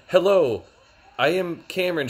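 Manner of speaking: hammed-up awkward guy voice; mock stiff greeting. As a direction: announce yourself stiffly and awkwardly. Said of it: "he's like".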